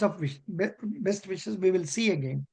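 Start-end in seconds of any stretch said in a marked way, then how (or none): none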